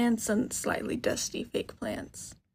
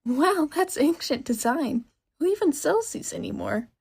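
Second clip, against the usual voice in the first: excited screech; 5 semitones higher, 5 dB louder than usual. Acted. Shopkeeper lunges over the desk at the hearing aid, screeching. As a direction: pounce on the old design. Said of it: "the screech of a grown woman".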